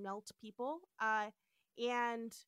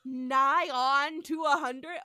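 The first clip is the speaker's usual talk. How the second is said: slow and louder, savoring the drawl; mock drawl; 3 semitones higher, 11 dB louder, slower than usual. Podcaster repeating the drawl, slow and loud. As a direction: stretch it out like an old-timer. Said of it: "repeats the drawled line".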